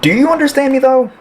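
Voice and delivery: high, frustrated plea; exasperated challenge. Acted. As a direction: demand to be understood, voice rising high. Patient pleads with clinician keeping him.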